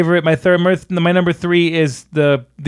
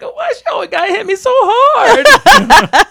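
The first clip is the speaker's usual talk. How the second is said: high, loud, slow wail; mock whining; 5 dB louder, slower than usual. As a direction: imitate a son whining about his mother. Imitating son complaining about mother.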